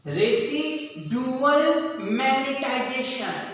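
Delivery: slow, drawn-out term for note-taking; slow dictation. Shown as a demonstration. Teacher gives students a key term to write, dictated slowly. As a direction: spell out the term syllable by syllable, slowly.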